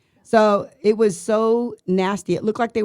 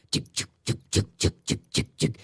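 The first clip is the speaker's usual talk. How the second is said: rhythmic voiceless clicking "chick"; imitated mic noise; 8 semitones lower, 4 dB quieter than usual. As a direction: mimic a bouncing radio mic in quick chicks. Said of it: "you can hear their mics going".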